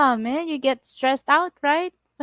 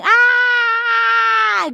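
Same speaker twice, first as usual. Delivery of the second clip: long, high, loud held scream; imitated scream; 13 semitones higher, 8 dB louder than usual. Driver retelling skidding with sister-in-law, reenacted scream. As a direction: scream it out and hold the note. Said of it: "we're both screaming".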